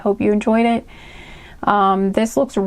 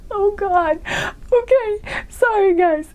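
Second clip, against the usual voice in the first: high voice still shaking with laughter; recovering from giggles; 11 semitones higher, slower than usual. Real, not acted. Reader apologising to viewers after cracking up, still laughing. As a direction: gasp the apology out through giggles. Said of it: "Maybe you're just very giggly".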